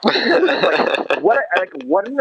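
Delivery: half-laughing in disbelief at his kindness; real laugh spilling into the words; laughing fondly. Real, not acted. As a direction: laugh it out, high and slowed. Fond memory of Reggie.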